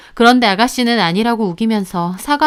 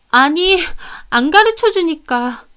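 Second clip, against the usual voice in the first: whiny excuse; 7 semitones higher than usual. Acted. Sister's sulky excuse.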